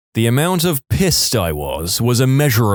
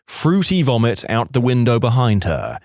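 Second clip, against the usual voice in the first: ordinary delivery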